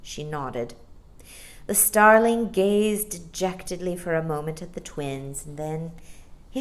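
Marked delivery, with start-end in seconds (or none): none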